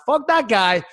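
high, fed-up mock rant; angry. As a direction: vent like you've had enough. Angry retold outburst.

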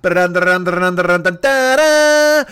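chanted cowboy tune; mock film-score riff; bouncy sung ba-da-dun music; hummed western theme.

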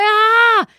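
sharp high scream of sudden pain. Retold or imitated. Stung in her car, she screams out in pain. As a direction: yelp like something just stung you.

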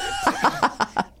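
squealing laugh, high. Real, not acted. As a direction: burst into a high giggle. Laughs at joke.

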